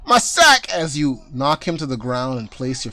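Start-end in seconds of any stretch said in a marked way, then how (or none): 0.1-0.7 high, loud squawk of loss; dismayed cry. Acted. Santa losing his sack, crying out high. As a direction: yelp as the sack flies. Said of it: "and he's".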